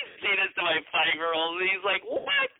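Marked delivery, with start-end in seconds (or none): 2.0-2.5 put-on very high squeaky "what" from a small boy; baffled child. Dad mimicking his five-year-old's reaction to "asset", high-pitched bafflement. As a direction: imitate a little kid's puzzled squeal.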